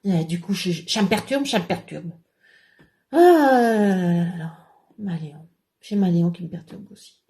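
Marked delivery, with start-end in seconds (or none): 3.1-4.6 long, loud falling lament; comic wail. Acted. Troubled comic character laments, long loud wail. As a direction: wail it out, drawn out and sliding down.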